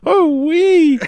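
very high, loud cartoon squeal; Meeseeks voice. Doing the show's catchphrase for a friend, high squealing voice.

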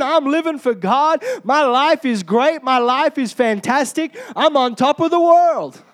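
pumped-up, victorious proclaiming; triumphant churchgoer. Acted. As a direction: build each boast toward a big finish. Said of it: "when we walk into church".

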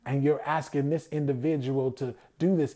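usual speaking voice

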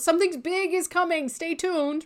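lilting parody of a hype post; mock teaser. Coach imitating entrepreneurs' social media teasers, high mocking voice.

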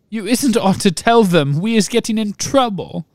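squeaky tearful house-elf. A narrator's reading. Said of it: "sobbed Winky".

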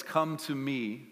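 slow, soft scripture reading; hushed slow reading of the verse. Real, not acted. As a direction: read it from a very deep place. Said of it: "So I'm gonna read it slow".

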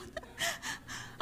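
a quick airy laugh at herself; breathy laugh. Real, not acted. Preacher laughing at her own hurry, airy laugh. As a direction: laugh it off, embarrassed.

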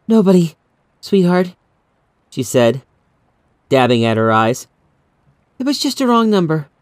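mother calming her frightened daughter; shaky reassurance. Acted. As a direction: soothe the child while hiding tears. Mother hiding tears from daughter.

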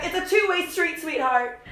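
high, loud mock scolding; exasperated comeback. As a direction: throw it out as a sassy, exasperated retort. Retold comeback to silent date.

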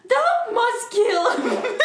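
high, loud, slow and laughing; hammy villain line, giggling through it; Voldemort voice. Retold or imitated. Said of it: "Voldemort voice".

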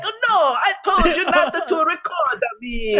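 put-on high, hammy Italian accent; fake Italian accent. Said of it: "a fake Italian accent".

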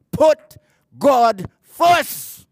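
loud, punched "put God first"; emphatic preaching. Real, not acted. Preacher urging the congregation, loud and emphatic. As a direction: hammer the point home to the church. Preacher drives home his point.

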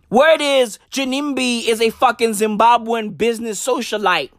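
ordinary talk, nothing marked

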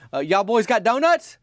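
a hungry man asking sweetly; Myron asking. Retold or imitated. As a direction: Myron asks the boys for donuts, hopeful. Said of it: "He's like".

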